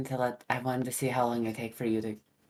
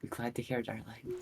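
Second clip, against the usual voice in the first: whisper; 7 dB quieter than usual. Pretend. Joking ASMR voice reply.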